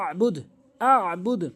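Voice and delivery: "আবুদ" with ayn pressed from mid-throat; correct ayn. Shown as a demonstration. Teacher modelling for learners, "আবুদ" with throat ayn. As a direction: hold the throat sound in "আবুদ". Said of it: "আইনের উচ্চারণ হচ্ছে হলকের মাঝখানে".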